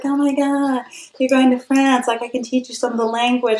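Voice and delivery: a lightly voiced eager French student, high and quick; bubbling over as the excited student offering lessons. The French student offering to teach her, lightly voiced and high-pitched. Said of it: "she was like".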